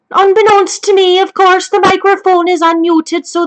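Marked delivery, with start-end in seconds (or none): none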